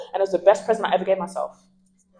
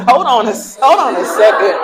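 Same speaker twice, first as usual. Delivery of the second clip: louder, laughing comeback; retorting; 4 semitones higher, 7 dB louder than usual. Retold or imitated. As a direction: cut him off with a laughing protest. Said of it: "I said".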